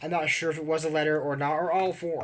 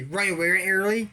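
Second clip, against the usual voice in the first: mock urgent warning; 4 semitones higher, 5 dB louder, faster than usual. Voicing kids in scene.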